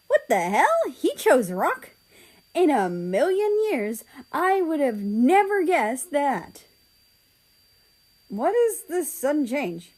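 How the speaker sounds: can't believe he chose rock; stunned disbelief. Acted. Narrator stunned after losing rock paper scissors, disbelieving.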